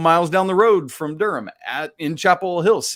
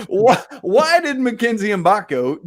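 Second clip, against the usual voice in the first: laughing restart after a flubbed joke; 9 semitones higher, 5 dB louder than usual. Real, not acted. Host to co-host after ruining the punchline, laughing. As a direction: fight through the giggles to ask.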